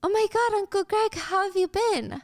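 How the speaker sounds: high, gushing, slowed joy; light up at an old face; delighted. Acted. Ari thrilled to see Greg.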